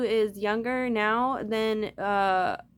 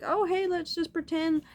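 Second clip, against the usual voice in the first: mocking naive people; 8 semitones higher than usual. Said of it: "people are like".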